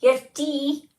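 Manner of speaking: high, loud exaggerated Scottish accent; hammed-up Scottish shout. Shown as a demonstration. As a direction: belt it out in a mock Scottish accent. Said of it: "with a Scottish accent".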